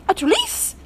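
high shocked French exclamation; gasp of horror. A narrator's reading. Mother mouse seeing her face in the mirror, horrified gasp. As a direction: gasp it at her own reflection. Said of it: "looked at her reflection and gasped".

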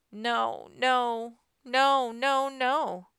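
mounting dismay; desperate protest. A narrator's reading. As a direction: watch it slipping away and plead. Smoke dies, character protests.

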